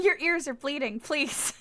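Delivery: ordinary talk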